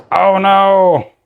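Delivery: sudden loud dismayed "oh no"; startled outburst, high and loud; shocked cry. Real, not acted. Figure breaks, sudden dismay.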